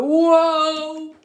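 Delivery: loud high slip cry; startled whoa. A narrator's reading. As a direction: yelp while slipping. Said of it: "He went".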